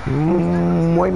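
ordinary talk, nothing marked

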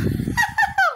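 very high shrieking giggle; giddy squeal laugh. Real, not acted. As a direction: let out a loopy tired shriek.

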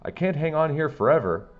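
high, loud desperate yell. A narrator's reading. Custodian clinging to the wall shouts down, high and loud. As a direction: shout it down, struggling to hold on. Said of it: "yelled down Mister Klutz".

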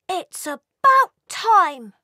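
a cheeky sibling welcoming him home; cheeky elf sibling teasing the returned brother, high and loud; Elphitrix. Acted. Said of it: "said Elphitrix".

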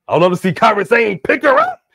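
loud, high, excited fan call; hyped up. Real, not acted. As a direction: cheer the move on loudly. Acts out excited wrestling wish.

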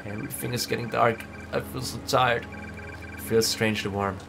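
fading dying voice. Acted. Fading into death.

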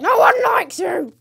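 high, loud, slow yelled jeer; shouted taunt. Acted. Gamer yelling at a mob in mock anger. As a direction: yell it at the mob.

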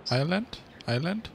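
Island as "Eye-lend", silent s, rising like a question; questioning pronunciation. Real, not acted. Player guessing at the island's name, questioning "Eye-lend" for Island. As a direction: try Island twice as "EYE-lend?", each lifting at the end. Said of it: "Keine Ahnung, wie man das richtig ausspricht".

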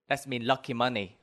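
ordinary delivery

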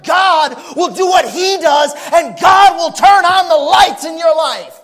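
building to a loud climax from the pulpit; full-force preaching. Acted. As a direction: bring it home to the congregation at full cry.

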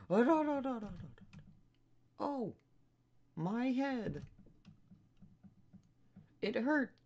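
put-on very high, groaning girl; high girl voice in pain. Gamer voicing Hinata waking up hurt, high pitched. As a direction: play a dazed girl clutching her aching head, high. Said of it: "I gave her the same voice as Rika".

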